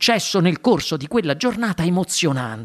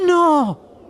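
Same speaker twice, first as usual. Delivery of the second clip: higher, louder cry; shout; 9 semitones higher, 4 dB louder than usual. Acted. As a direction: stop him in alarm. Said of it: "gridò Charlie". (grido alto e forte)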